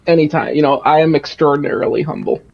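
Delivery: booming, self-important joking; loud mock boast. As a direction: project it like a showman. Boasting loudly after loudness jokes.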